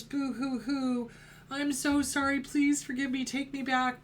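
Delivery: theatrical boo-hoo apology; mock crocodile-tear sobbing. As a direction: fake sob to win them back. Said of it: "crocodile tears".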